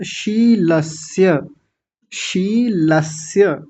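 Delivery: शीलस्य slowly as shee-las-ya; distinct sibilants. Shown as a demonstration. Teacher demonstrating for class, शीलस्य with clear sh then s. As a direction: keep sh and s apart in शीलस्य. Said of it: "दोनों का अलग-अलग उच्चारण होना चाहिए".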